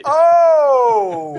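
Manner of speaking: long, loud, high oh; shocked outcry. Real, not acted. Host watches a rim hang go wrong, loud shocked outcry. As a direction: cry out at a sudden disaster. Reacts to disaster in clip.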